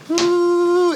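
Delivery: high, sustained "ooh" hum; vibrating hum. Retold or imitated. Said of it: "it's a vibration".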